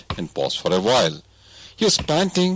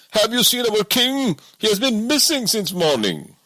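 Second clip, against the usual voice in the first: higher, anxious bodyguard; a guard searching for his master. A narrator's reading. Bodyguard asks about missing king.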